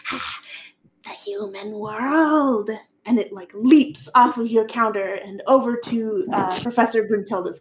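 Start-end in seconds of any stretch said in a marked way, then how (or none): 0.1-2.7 cackling little imp, slow; gleeful tiny imp voice; lightly voiced mischievous imp; imp laugh. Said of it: "And it's like".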